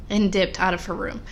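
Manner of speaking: ordinary talk, nothing marked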